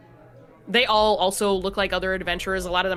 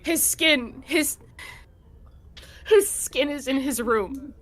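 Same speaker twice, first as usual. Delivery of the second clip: higher, trembling, trying to hold it together; shaken grieving mother; 7 semitones higher than usual. Acted. Mother explaining to the party her son's skin was left, shaken and grieving. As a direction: fight back tears while explaining. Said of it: "takes a breath and tries to calm down".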